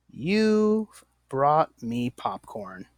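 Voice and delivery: You've brought me popcorn stretched out slowly; slow example sentence. Shown as a demonstration. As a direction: read it slowly, like a model sentence. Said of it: "It's faster to say".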